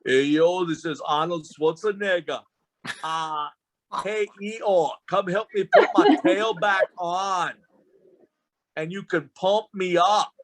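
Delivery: put-on swagger it like Arnold in an Austrian accent; hammed-up Austrian Arnold accent; Arnold Schwarzenegger impression. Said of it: "can you imagine".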